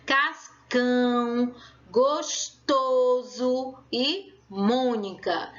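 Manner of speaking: slow "cascão, gostoso e Mônica", leaning on CÃO, TO and MÔ; stressed tonic syllables. Shown as a demonstration. Teacher showing pupils the strong syllables, "cascão, gostoso e Mônica" slowly stressed. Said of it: "eu coloco mais força para pronunciar".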